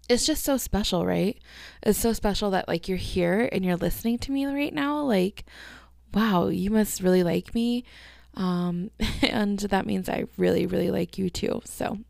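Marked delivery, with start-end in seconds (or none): none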